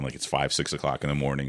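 usual speaking voice

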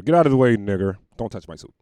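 a snarling street bigot. Retold or imitated. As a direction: bark it like he owns the sidewalk. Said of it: "He look like he'll call me".

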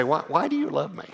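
imitating his own needy question to his wife; mock needy pleading.

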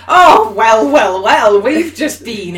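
smug know-it-all. Retold or imitated. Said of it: "some tosser will pipe in".

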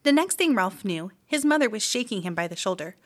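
usual speaking voice

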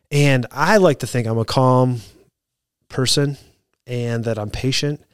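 ordinary talk, nothing marked

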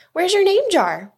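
put-on high, urgent child's question; high-pitched puzzled demand; startled question. A narrator's reading. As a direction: ask it in surprise, pitch way up. Ralph asks about the missing jar.

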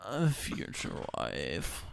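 low, slow sultry whisper; imitating a husky seductive voice; sexy voice. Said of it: "trying to put on the sexy voice".

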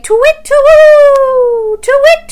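loud, very high, long hoots; hooting owl. Pretend. Presenter getting children to be owls, loud high hooting. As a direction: hoot and hold the twoo. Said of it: "be an owl again".

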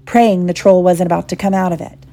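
usual speaking voice

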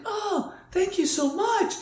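high, slow sweet thank-you; mock sugary gratitude; girly thanks. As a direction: imitate the grateful girls. Girls thanking driver.

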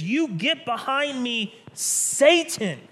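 slow, building to a loud Satan; rebuke, rising to a shout; Jesus turning on Peter, stern and loud; Jesus rebuking. Acted. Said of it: "He says".

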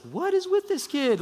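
very high, incredulous exclamation; mock bewilderment. Onlookers baffled by the boy Jesus, high-pitched mock amazement. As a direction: squeal it in comic disbelief. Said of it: "they're just like".

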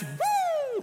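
high imitated police siren whoop. Teen describing the police car lighting him up, imitating the siren. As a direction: mimic the siren going off.

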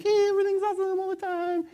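high, fast, fake-bright chirp; sing-song pretend positivity; mock cheery. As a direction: play the relentlessly upbeat poser. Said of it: "and you're kind of like".

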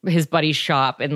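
normal talking voice

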